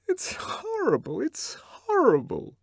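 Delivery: high, slow, tearful repeating; crying. A narrator's reading. Lenina crying to Bernard, high and tearful. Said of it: "But Lenina was crying / she kept repeating".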